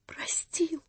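a gasped whisper of joy; breathless whisper. A narrator's reading. Mother whispering in joyful disbelief. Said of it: "вскричала Анна Андреевна".

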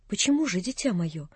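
put-on soft, tender old man; tender plea. A narrator's reading. Old man gently asking the girl why, softly tender. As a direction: ask gently, almost hushed.

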